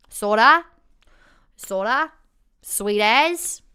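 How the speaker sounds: drawn-out, lazy Kiwi delivery; lazy New Zealand accent. Shown as a demonstration. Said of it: "they just go. Like, they just".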